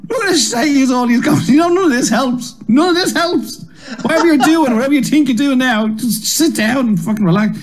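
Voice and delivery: high, shouty, exasperated rant; Mark Lamar. Retold or imitated. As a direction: hammer it home like a fed-up comic scolding nervous newcomers.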